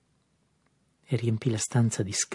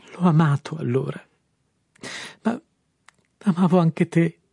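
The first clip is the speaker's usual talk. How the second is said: sobbing; 6 semitones higher, 6 dB louder than usual. A narrator's reading. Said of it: "Incominciò a singhiozzare".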